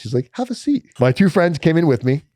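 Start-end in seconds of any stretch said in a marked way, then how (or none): 0.3-0.8 high, sweet mock-friendly guard; nice guard. Front desk guard welcomes him, high sugary friendliness. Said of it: "he was really nice".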